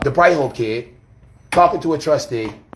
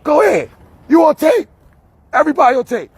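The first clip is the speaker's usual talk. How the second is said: high, loud taunt; calling out everyone filming the scene; loud defiant warning; 12 semitones higher, 5 dB louder than usual. Real, not acted. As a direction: dare them, voice way up. Warning accusers they are filmed.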